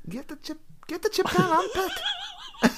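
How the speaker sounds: squeaky high Geordie voice; high-pitched Geordie accent. Acted. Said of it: "Why is it in a high voice?".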